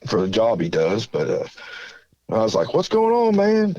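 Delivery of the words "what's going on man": put-on high, eager hey to an old friend; retold greeting jumped up high. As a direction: pitch the hello way up, friendly. Greeting an older acquaintance.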